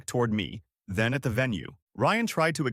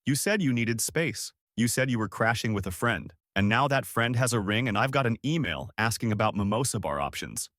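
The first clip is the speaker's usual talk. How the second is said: plain reading voice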